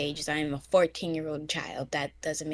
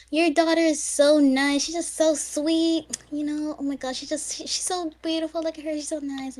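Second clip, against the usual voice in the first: higher, fawning relative voice; gushing relatives; 9 semitones higher, 5 dB louder than usual. Retold or imitated. Mimicking relatives praising her to her mother, higher and gushing. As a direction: gush like an over-sweet aunt. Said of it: "because they're like".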